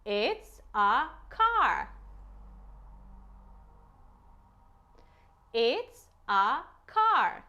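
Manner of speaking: very slow, careful; slow model word. Shown as a demonstration. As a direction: say it slowly for the child to copy. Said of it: "Say car".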